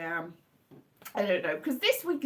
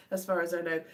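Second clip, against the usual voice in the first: usual speaking voice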